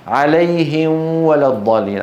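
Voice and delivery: عليهم ولا with the م hidden, humming into the w; wrong ikhfa at waw. Shown as a demonstration. Teacher shows the fourth mistake, عليهم with hidden mim. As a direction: blur the م of عليهم into the following waw. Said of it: "الخطأ الرابع إخفاؤها عند الواو".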